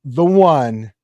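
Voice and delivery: loud, slow big-show announcer. Acted. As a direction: build up the grand introduction. Announcing the next player.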